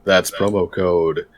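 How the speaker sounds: smooth game show host pitch; radio announcer voice. Acted. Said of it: "calm, like, radio announcer voice".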